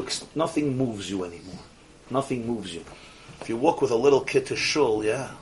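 ordinary delivery

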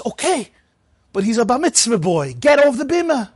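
high, commanding order to step down; stern gabbai. Retold or imitated. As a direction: send him off the bima, pitched high.

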